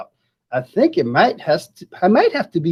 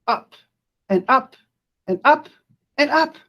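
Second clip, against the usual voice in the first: each "up" higher than the one before; rising step by step; 9 semitones higher than usual. A narrator's reading. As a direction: climb the ladder with your voice. Jabari climbing the ladder.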